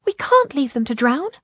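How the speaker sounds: high, loud urgent appeal; shouted protest. A narrator's reading. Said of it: "Isolde shouted".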